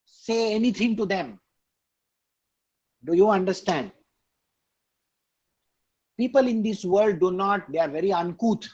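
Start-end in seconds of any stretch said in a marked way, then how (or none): none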